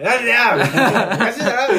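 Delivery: loud laughing yell; shouting from the back. Retold or imitated. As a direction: call out across a lecture hall. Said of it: "一番後ろの方から".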